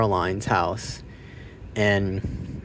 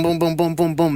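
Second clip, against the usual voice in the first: mock gunfire; 7 semitones higher than usual. Imitating gunfire.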